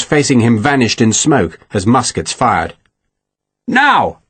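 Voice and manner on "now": high-pitched one-word signal; calling out. A narrator's reading. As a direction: call the go signal to comrades. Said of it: "Sharp called".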